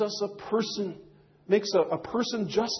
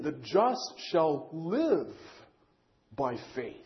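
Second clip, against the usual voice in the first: "live" leaned on, slow, fading softer to "by faith"; stress on live; 5 semitones lower, 6 dB quieter, slower than usual. Shown as a demonstration. Preacher explaining Galatians, slowly stressing "live". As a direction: dwell on "live", then drop away. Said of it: "the emphasis is stressed on, on living".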